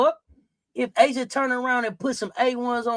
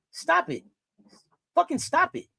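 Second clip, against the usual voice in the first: exasperated; 7 semitones higher than usual. Real, not acted. Exasperated at chat's opinion.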